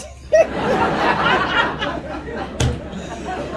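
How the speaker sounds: airy high-pitched laughter. Real, not acted. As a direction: crack up. Laughing at the mix-up.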